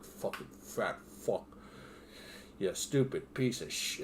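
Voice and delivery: imitated inner voice of self-loathing; self-disgusted muttering. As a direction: curse yourself under your breath. Voices own self-disgust after bingeing.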